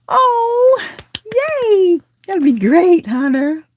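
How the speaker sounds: high-pitched delighted cheer; gasp, then cheer in a high, thrilled voice. Real, not acted. Delighted reply to viewer's message.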